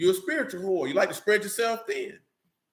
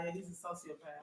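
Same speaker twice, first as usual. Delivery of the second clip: hushed mock therapist; 2 semitones lower, 22 dB quieter than usual. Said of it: "and be like".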